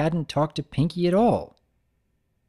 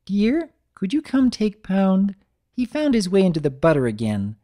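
Missus Cake voice; 7 semitones higher, 4 dB louder than usual. Acted. Wife calling her husband about the foal, high. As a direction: call sweetly from the doorway.

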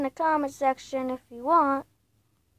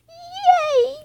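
high, loud cartoon squeal; silly high voice; 9 semitones higher, 7 dB louder than usual. Gamer shows viewers his silly high voice. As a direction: chirp it out hammy and high. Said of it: "I love doing that voice".

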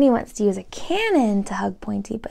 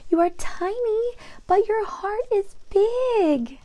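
tender mother; 8 semitones higher than usual. Acted. Mother comforts Tiny.

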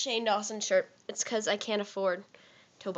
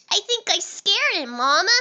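put-on high, loud little boy; 9 semitones higher, 9 dB louder than usual. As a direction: play a worried little kid calling mom. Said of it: "I can sound like a little boy".

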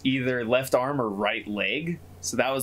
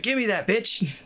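high, pushy snatch-and-run demand; high-pitched mock yell; 10 semitones higher than usual. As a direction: blurt it out high, like a mugger. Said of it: "Not even yelling it".

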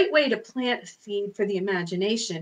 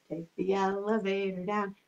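low and soft for down; 5 semitones lower, 9 dB quieter than usual. Shown as a demonstration. Elevator rhyme, low down.

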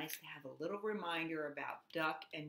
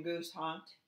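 ordinary delivery

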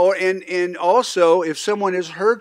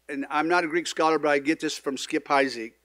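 ordinary delivery

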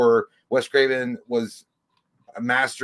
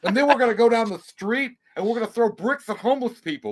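higher, hyped fake-cheerful vlogger voice; mock prank YouTuber; 6 semitones higher, 4 dB louder than usual. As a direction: ham up a clueless prank influencer.

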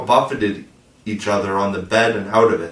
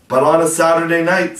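insinuating; 7 semitones higher, 6 dB louder than usual. A narrator's reading. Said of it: "slightly insinuated".

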